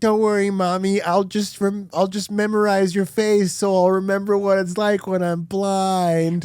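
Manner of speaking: put-on high kid voice; small boy talking to his mom; little kid voice. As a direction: voice the brave little son. Kid voiced in retold drama.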